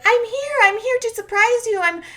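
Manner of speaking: very high, loud squealed greeting; mock excited surprise. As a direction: imitate her giddy arrival, way up high. Acting out Varya's surprise arrival.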